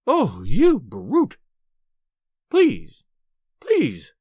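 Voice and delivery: loud, drawn-out protest giving way to pleading; outraged wife reduced to pleading; grudging plea. A narrator's reading. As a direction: give in and beg, still indignant. Stranded wife gives in, pleading.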